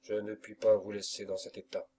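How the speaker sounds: usual speaking voice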